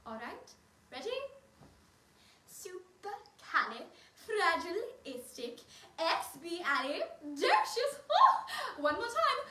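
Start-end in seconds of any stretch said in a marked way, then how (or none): 2.5-7.9 slowly sounded out. Shown as a demonstration. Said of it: "see how fast we can say it". 8.1-8.5 delighted oh. Acted. Delighted at her own fast take.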